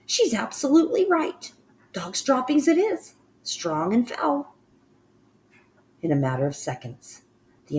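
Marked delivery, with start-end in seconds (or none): 0.1-4.6 high witch voice, slowing on the end in disgust. Acted. Witch agrees with Mildred, high and disgusted. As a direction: savour the foul smell.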